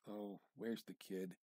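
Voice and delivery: ordinary delivery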